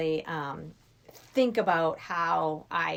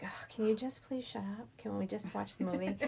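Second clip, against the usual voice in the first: annoyed whisper; 2 semitones higher, 8 dB quieter than usual. Retold or imitated. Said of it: "they're like".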